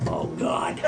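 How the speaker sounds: low, hushed groan of comic dread; mock dread. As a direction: sink into a quiet, retold moment of panic. Retelling dread while awaiting her answer.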